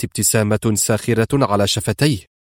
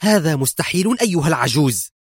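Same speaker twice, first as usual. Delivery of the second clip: higher-pitched indignant retort; exasperated; 5 semitones higher than usual. A narrator's reading. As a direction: protest with contained fury. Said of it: "فقالت بغيظ".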